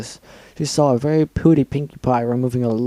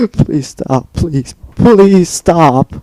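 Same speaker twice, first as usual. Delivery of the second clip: slow, loud wailing plea; crying and begging; 5 semitones higher, 8 dB louder, much slower than usual. Acted. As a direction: beg through tears. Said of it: "burst out crying and begged".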